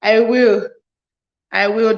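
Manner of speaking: usual speaking voice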